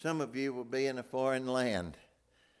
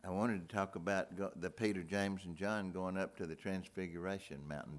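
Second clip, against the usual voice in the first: normal talking voice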